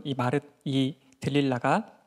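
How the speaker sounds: usual speaking voice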